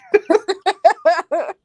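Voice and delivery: high, loud burst of laughter. Real, not acted. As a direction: crack up over an inside joke. Amused at an inside joke.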